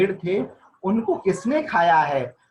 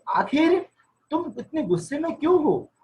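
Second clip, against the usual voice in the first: much higher, a questioning neighbor; puzzled neighbor; 8 semitones higher than usual. Retold or imitated. Neighbor asks the angry husband, in puzzled surprise. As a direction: ask it as a baffled neighbor would. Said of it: "Sheikh chilli से उन्होंने पूछा".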